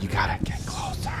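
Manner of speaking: quiet whispered answer; whispering. Real, not acted. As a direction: whisper it back. Whispered reply.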